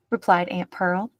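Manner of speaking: usual speaking voice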